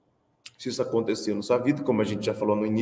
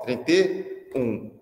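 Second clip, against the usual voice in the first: slow, spelled-out drill; slow drilled repeat; much slower than usual. Retold or imitated. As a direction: say it slowly so the kids memorize it. Drilling the bus number slowly. (professor repete devagar o número do ônibus para as crianças)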